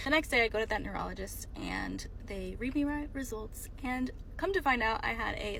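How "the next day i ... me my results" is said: ordinary talk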